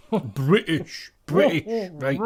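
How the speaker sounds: practised English-accent single word; put-on English accent. Shown as a demonstration. Pupil proving it foolproof to Scotty, put-on English accent. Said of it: "in, in the accent".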